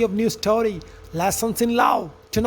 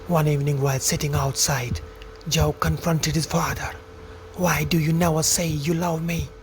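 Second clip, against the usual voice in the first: lower, measured storyteller voice; story narration; 6 semitones lower, 4 dB quieter than usual. A narrator's reading. Narrator telling friends of farmer Jonathan and son Joe, story narration. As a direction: narrate it like a fable, evenly.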